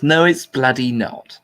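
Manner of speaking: ordinary talk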